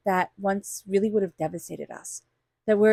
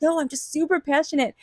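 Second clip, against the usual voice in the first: higher-pitched, passionate protest; acted-out passionate reply; super passionate; 7 semitones higher than usual. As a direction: insist with mock passion, pitch lifted. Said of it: "We're very passionate".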